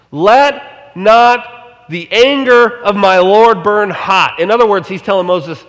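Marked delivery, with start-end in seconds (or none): 0.1-4.4 Aaron pleading slowly. Retold or imitated. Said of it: "He first says".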